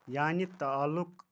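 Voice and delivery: normal talking voice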